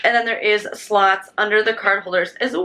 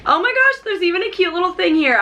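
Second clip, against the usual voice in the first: excited squeal; 8 semitones higher than usual. Real, not acted. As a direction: squeal at the surprise. Unboxing reveal squeal.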